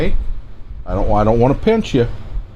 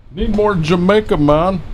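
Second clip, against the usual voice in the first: a self-mocking, higher-pitched stab at a Jamaican accent; 9 semitones higher than usual. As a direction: toss off a quick, joking Jamaican accent, pitched up. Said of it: "That's the best Jamaican accent I can give you".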